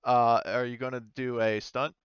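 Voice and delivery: ordinary delivery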